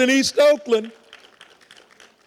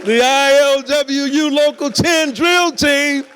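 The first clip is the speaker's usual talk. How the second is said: announcer; 3 semitones higher, 5 dB louder than usual. Real, not acted. Emcee announcing the team.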